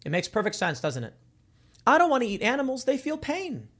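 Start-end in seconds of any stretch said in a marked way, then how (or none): 1.9-3.7 higher, whiny mock of a vegan; lightly mocking activist. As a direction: parody the vegan plea, pitched up. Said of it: "what do they say?".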